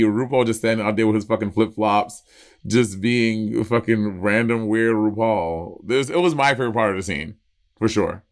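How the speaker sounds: ordinary talk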